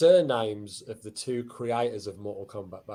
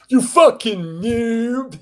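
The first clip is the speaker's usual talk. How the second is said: slow, loud high taunt; angry gamer; 12 semitones higher, 8 dB louder, much slower than usual. Acted. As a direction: spit it at a bad player. Gamer insult, acted.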